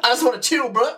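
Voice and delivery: high, laughing slacker whine; mock a friend who just wants to chill; lazy friend. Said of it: "That's what a lot of my friends would say".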